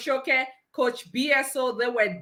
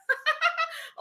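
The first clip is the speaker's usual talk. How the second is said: very high-pitched giggle; tickled laugh; 5 dB quieter than usual. Real, not acted. Host amused after defying the devil, high giggle.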